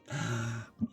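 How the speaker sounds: soft gasp of disbelief; sharp disbelieving gasp. Real, not acted. Viewer-facing gamer gasping at the sidekick's lines. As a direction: catch your breath in shock.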